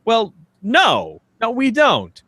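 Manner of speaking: usual speaking voice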